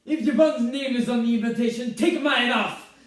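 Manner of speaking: loud, high furious outburst; outraged mother. Acted. Mother refusing to share the invitation, furious. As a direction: explode down the phone in outrage.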